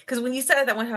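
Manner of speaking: ordinary talk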